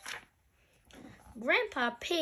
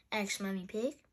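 usual speaking voice

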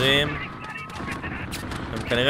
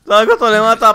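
laughing; 5 semitones higher, 7 dB louder than usual. Real, not acted. Laughs at the scene.

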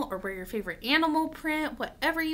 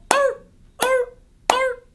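high, loud seal barks; 12 semitones higher, 13 dB louder, slower than usual. Acted. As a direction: bark out short seal calls. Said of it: "like a seal, and they go".

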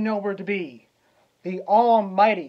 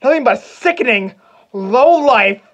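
furious, full-voiced threats, pitch raised; enraged shouting; 3 semitones higher, 9 dB louder than usual. Hamlet threatens the uncle who killed his father, enraged shout. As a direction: shout it at him in a vengeful rage.

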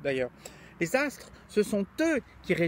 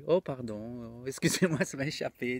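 gespielt zerknirscht; 6 semitones lower than usual. Pretend. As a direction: feign a sheepish apology, pitched low. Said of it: "avec un air contrit".

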